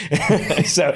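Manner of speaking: chuckling through a joking line; laughing. Real, not acted. Giving listeners dating advice, laughing at own joke. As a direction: let a laugh break in on marry you.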